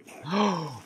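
startled gasp. Real, not acted. Enemies suddenly attack.

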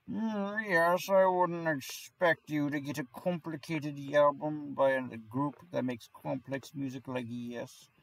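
high, slow snooty music snob; pompous voice. Acted. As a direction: sniff down your nose at them, slowly. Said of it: "I sound really pompous talking like that".

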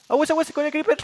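loud, high alarmed warning shout. Real, not acted. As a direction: yell a sudden warning. Warning about a creeper. (advertencia gritada)

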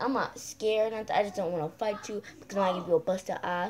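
ordinary talk, nothing marked

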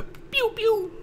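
high-pitched laser pew-pew; imitated gunfire pew. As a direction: squeak out little toy-gun shots.

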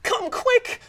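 very high, urgent plea; alarmed cry. A narrator's reading. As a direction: cry out for help. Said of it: "Mother Rabbit cries".

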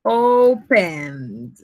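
teacher says "Opened" for the chart; modelled word. Shown as a demonstration. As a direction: present the past form on its own. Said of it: "lo ponemos acá".

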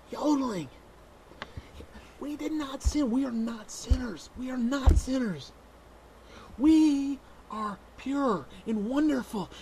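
high, loud fervent sermon voice; hammed-up fire-and-brimstone preacher; mock preaching. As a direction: preach it like a zealot. Fanatic preaching.

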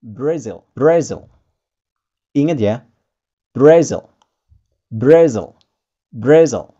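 Brazil said bruh-ZEL, loud; loud teaching Brazil; English Brazil. Shown as a demonstration. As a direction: Brazil with a reduced second vowel. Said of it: "Brazil bisa kita ucapkan dengan".

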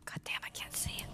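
hoarse frantic whisper; panicked whisper. Real, not acted. Streamer blind in the dark with the monster near, frantic whisper. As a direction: whisper in fear of being heard.

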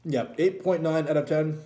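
speaking between bites of burger; talking while chewing. Real, not acted. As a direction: mouth partly full of food. Seen 5: Holds bitten burger; cheeks puffed, lips working while speaking between bites.

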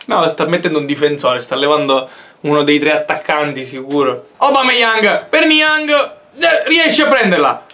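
ordinary talk, nothing marked

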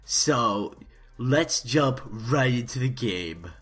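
over-the-top Let's Play host; YouTuber intro. Acted. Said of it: "YouTuber intro voice".

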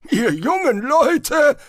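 put-on offended old wizard hitting back; hissed retort. A narrator's reading. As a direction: snap it back as a testy old man. Spolt grumbles on.

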